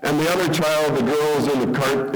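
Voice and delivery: normal talking voice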